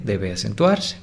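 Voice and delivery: ordinary delivery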